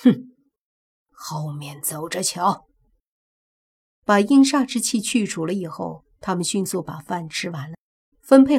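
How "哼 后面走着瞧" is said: slow, low grudging mutter; huff, then vow revenge through gritted teeth; gritted-teeth threat. Acted. Village head vows to get back at them, gritted-teeth threat. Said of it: "他又咬了咬牙".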